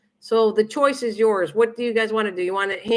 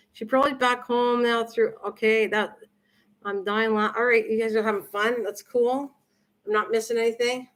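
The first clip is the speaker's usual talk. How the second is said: usual speaking voice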